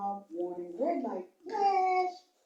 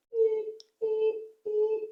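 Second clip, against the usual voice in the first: mock truck beeping; 9 semitones higher than usual. Imitating truck backing horn.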